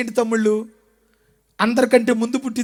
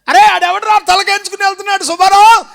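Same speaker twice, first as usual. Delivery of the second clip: higher, louder rustic elder calling out; village elder voice; 6 semitones higher, 6 dB louder than usual. Acted. Village elder asking Subbarao about Jephthah, loud rustic voice.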